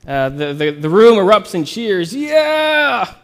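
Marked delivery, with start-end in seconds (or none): 2.1-3.2 cheering. Acted. Crowd cheering.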